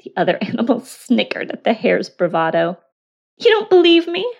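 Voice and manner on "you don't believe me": high, loud disbelief; indignant hare. Acted. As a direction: scoff in a raised voice. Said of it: "asked the hare".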